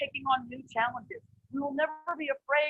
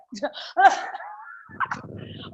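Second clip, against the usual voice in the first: airy laugh; 5 semitones higher, 4 dB louder than usual. Real, not acted. Delighted at the perfect verse.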